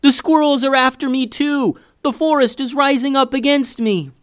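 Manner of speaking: high, loud, melodramatic wail; panicked yelling. A narrator's reading. Boy chased by snake and squirrel, yelling in panic. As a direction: yell as if everything is after you. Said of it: "yelled Eli, noticing the squirrel".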